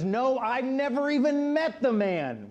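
high, drawn-out angry shout; angry denial. Retold or imitated. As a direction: shout the denial, stretching it out in anger. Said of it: "now Peter gets angry and says".

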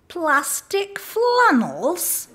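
a baffled woman peering over her glasses; puzzled shopkeeper. Retold or imitated. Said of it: "and said".

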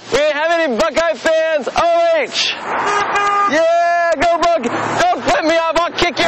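high, peppy cheerleader yells. Acted. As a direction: pump up the crowd like a cheerleader. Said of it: "I'm in an Ohio State cheerleader uniform".

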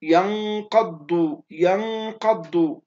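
"ينقض" very slow, held nasal "ن" into thick "ق" and "ض"; heavy QAF and DAD. Shown as a demonstration. Teacher shows students two heavy letters, "ينقض" drawn out and thickened. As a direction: stretch "ينقض" right out, full-mouthed on "ق" and "ض". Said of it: "حكم حرف القاف نقول ينقض".

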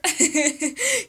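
short bright burst of laughter. Real, not acted. Explaining breathwork to viewers, breaks into a quick laugh. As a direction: laugh at your own point about breathing.

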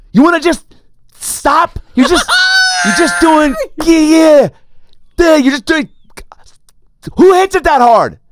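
high, loud mock outburst, slow at first; hammed-up shout of an irate stranger; angry passenger.

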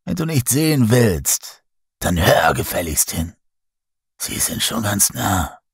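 lightly voiced old storyteller, stern; old man's warning. A narrator's reading. Old man rebukes the doubting sailor, grave warning. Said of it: "unterbricht der Alte mich mit erhobener Hand".

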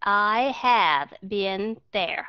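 "I have been there" very slowly, word by word; very slow model. Shown as a demonstration. Teacher reading very slowly for dictation. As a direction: drag out every word so students hear "been". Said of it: "những cái trường hợp đặc biệt".

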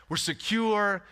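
ordinary talk

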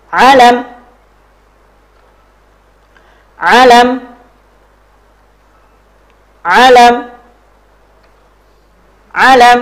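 high, loud model word, evenly repeated; drill call-out. Shown as a demonstration. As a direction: call it out and leave room to repeat.

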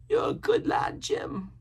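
high, kindly sailor voice; approving castaway. A narrator's reading. Castaway patting Jim on the head, approving. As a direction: praise the boy in a high voice.

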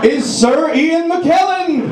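hammed-up slow announcer call; grand announcer intro. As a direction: boom out the introduction like a stadium host.

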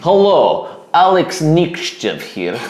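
mock Russian accent, slow, breaking into a laugh; a jokey Russian accent intro. Opening the review in a mock Russian accent for the crew. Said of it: "in my Russian accent".

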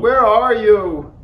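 high, loud, drawn call for a friend; searching call. A narrator's reading. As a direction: call out for someone who has vanished. Toad calls for missing Frog.

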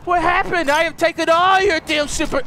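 high, loud gleeful yelling; shouting. Real, not acted. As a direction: yell after them in glee. Shouting taunts in fight.

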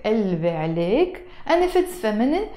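ordinary talk

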